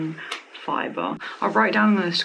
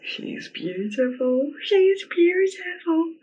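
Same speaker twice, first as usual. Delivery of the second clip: slow, rising, much higher gush of delight; drawn-out high-pitched adoration; 12 semitones higher, slower than usual. Real, not acted. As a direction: swoon over it, climbing up. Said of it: "I'm just so emotional".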